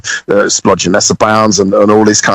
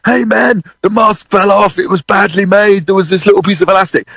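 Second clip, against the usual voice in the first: mock shriek; 8 semitones higher than usual. Drummer explaining fallen mask to singer over playback, mock shriek. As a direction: imitate shrieking an excuse, voice held high. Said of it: "I'm trying to shriek back to him".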